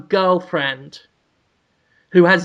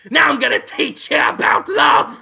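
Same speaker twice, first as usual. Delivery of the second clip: high, shouted cartoon cat; bossy cat declaring its plan; Willem Dafoe; 8 semitones higher than usual.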